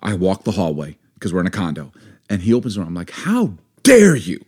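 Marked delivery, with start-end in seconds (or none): 3.1-4.4 slow, raised mock outrage; indignant. Wife confronts neighbour at his door, indignant. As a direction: stretch each word in affronted retort.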